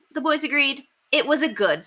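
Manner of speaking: ordinary delivery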